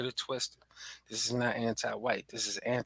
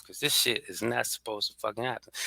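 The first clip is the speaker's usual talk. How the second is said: usual speaking voice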